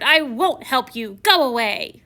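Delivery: loud, slow, harsh refusal; roared refusal. Retold or imitated. Prince still in troll mode roaring at the old woman. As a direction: drive her off like a nasty troll. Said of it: "I roared".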